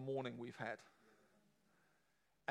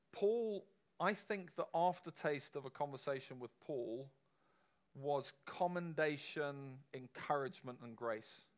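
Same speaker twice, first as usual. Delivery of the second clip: normal talking voice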